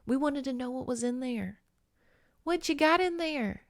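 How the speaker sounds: a hint of an eager little girl, pitch lifting; lightly voiced curious child peering in; childlike curiosity. Little girls obsessed with sea.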